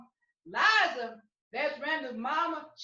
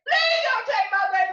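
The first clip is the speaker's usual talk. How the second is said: the same plea pushed louder and higher, near a scream; 6 semitones higher, 9 dB louder than usual. Acted. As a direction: raise the begging to a scream. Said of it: "tears were falling down her face".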